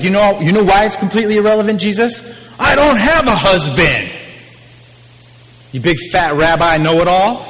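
mock-outraged woman, high and loud; indignant mockery. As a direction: scoff at the know-it-all. Woman mocks Jesus.

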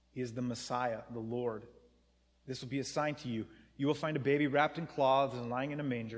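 soft, hushed reading of the angel's words; quiet scripture reading. Acted. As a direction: read it gently, as the angel comforting shepherds.

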